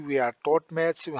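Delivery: very slow, word by word for the class; slow classroom dictation. Shown as a demonstration. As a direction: spell it out slowly for students to copy. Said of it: "अब आपने practice करनी है".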